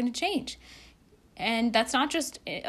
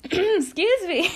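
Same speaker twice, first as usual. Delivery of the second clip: much higher, louder, slow excuse me that cracks; cracking squeaky voice; 10 semitones higher, 8 dB louder, slower than usual. Real, not acted. Vlogger's odd morning voice cracking high mid-apology. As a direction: let Excuse me squeak way up as the voice breaks. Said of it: "My voice is, like, so weird this morning".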